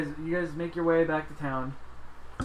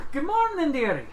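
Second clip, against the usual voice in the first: put-on cheery old lady voice; 10 semitones higher, 4 dB louder than usual. GM voicing a cheery NPC greeting.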